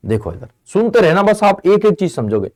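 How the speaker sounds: ordinary talk, nothing marked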